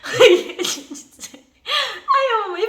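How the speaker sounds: high, breaking into laughter mid-word; laughing. Real, not acted. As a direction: laugh through the words. Laughing retelling her words.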